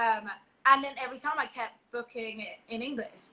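usual speaking voice

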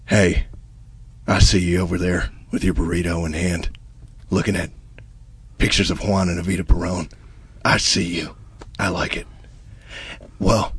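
low, hushed seductive commercial read; sultry ad voice. Acted. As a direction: purr the pitch like a late-night ad. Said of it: "Give me a beat".

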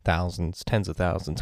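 ordinary talk, nothing marked

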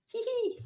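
high little "hee hee"; light, high acted titter; read-aloud giggle. A narrator's reading. Robot giggles at Ribbit's remark, tittering. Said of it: "giggled Robot".

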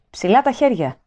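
a child playing soldier, lightly shouted; mild mock yell. A narrator's reading. Boy ambushing Winston with toy gun, mock yell. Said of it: "ούρλιαξε μια άγρια φωνή".

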